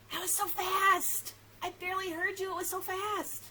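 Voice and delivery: high, loud, playful exclaiming; excited amazement. Real, not acted. Teacher exclaims over fast hello.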